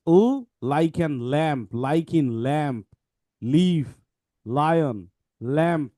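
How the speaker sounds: slow, careful reading of the phonics line; reading slowly. Shown as a demonstration. Teacher reading the book's example words aloud, slowly. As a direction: spell each word out slowly for the class. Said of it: "তাহলে it says".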